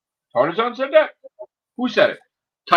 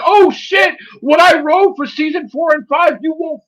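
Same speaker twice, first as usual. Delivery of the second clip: high, loud gushing boast; mock excited writer; 11 semitones higher, 6 dB louder than usual. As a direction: brag like you can't contain the secret. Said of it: "He gets excited".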